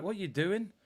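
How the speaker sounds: put-on a light Yorkshire accent, rising question; mock Stones in Yorkshire accent. Stones questioning the foul, light Yorkshire accent. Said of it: "With his Yorkshire accent".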